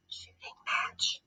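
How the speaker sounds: hushed, mysterious title reveal; dramatic whisper. Acted. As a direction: whisper the chapter title slowly. Teacher announces chapter title.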